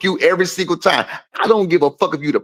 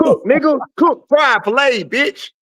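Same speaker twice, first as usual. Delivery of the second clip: gloating hype; 7 semitones higher than usual. Real, not acted. Gloating over the win.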